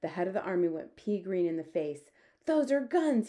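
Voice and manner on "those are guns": high, loud alarm; frightened, raised outburst; panicked cry. A narrator's reading. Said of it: "he cried".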